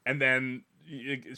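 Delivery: normal talking voice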